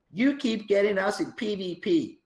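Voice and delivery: game officer scolding the guild in a mock American accent; American accent impression. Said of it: "your American accent impression".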